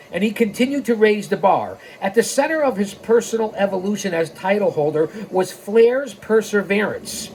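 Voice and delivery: ordinary delivery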